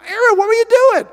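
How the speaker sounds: high, loud demanding question; exasperated Moses confronting his brother. Retold or imitated. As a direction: call Aaron out sharply.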